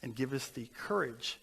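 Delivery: soft, steady pastoral prayer voice; earnest prayer. Acted. Pastor leads the congregation in a closing prayer. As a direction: lift it up to God humbly.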